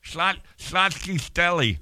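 slushy lateral lisp on "Schlotsky's", slow and high. Pretend. Host clowning over a deli name, "Schlotsky's" in a silly lateral lisp. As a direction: slur the s sounds of "Schlotsky's" out the sides of the tongue. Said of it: "It's a lateral lisp".

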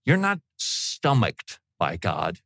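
ordinary delivery

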